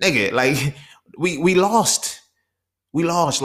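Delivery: loud, high, slow despairing cry of loss; exasperated lament. Real, not acted. Venting to viewers about men, a loud despairing lament. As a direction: mourn it like a lost battle.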